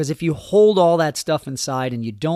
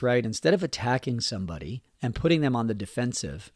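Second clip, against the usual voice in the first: normal talking voice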